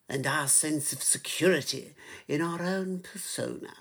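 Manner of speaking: put-on British accent, softly spoken; mock British public-speaker voice; John Cleese. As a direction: play a British comic orator, quietly. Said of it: "it became like a John Cleese voice".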